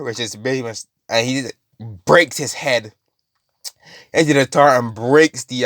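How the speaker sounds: plain reading voice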